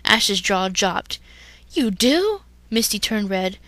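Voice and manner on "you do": surprised. Acted. Surprised question.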